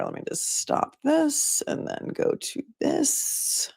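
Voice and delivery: slow, breathy under-the-breath note; whispered aside. Real, not acted. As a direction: whisper to yourself while clicking. Muttered aside while switching screens.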